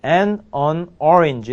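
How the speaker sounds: very slow, word by word; drawn-out teaching demo; slow model sentence. Shown as a demonstration. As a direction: lay out each word slowly for the class. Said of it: "이렇게 됩니다".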